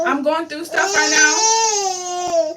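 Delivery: high, slow, wailing sob; sobbing. Real, not acted. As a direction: plead while sobbing. Sobbing to her baby.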